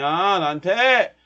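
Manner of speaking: ordinary delivery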